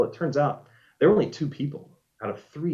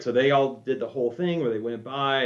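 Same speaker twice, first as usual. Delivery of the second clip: normal talking voice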